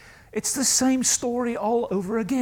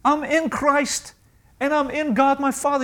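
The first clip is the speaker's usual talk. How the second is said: bold answer; 7 semitones higher, 4 dB louder than usual. Acted. Answering the churchgoer.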